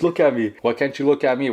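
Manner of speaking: insistent but controlled challenge; retold confrontation. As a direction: retell confronting someone firmly, without shouting. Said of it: "But I was like".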